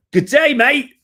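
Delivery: loud, high mock-Australian greeting; hammed-up Aussie accent, pitched high; rubbish Australian accent. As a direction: belt out a badly faked Australian accent. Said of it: "rubbish Australian accent".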